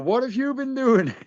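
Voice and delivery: high teasing question, strange voice; mock high voice. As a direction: squeak it out like nosy people.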